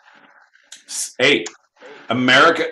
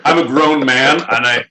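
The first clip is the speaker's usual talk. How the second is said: ordinary delivery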